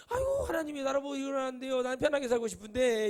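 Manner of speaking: mock wailing. Prophet begging God for an easy life, hammed-up crying. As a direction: act out a prophet bawling to be let off. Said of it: "막 울고 불고".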